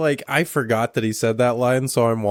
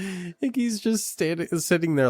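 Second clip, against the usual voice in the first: excited; 9 semitones higher than usual. Real, not acted. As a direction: build the excitement. Excited retelling.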